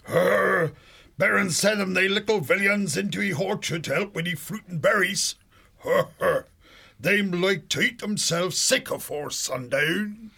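high, rustic country burr; mole dialect. Acted. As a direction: chuckle about little villains in dialect.

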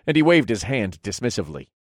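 plain reading voice

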